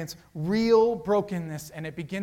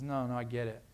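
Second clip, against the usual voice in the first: soft understanding; 6 semitones lower, 7 dB quieter than usual. Acted. Jesus comforting, quiet.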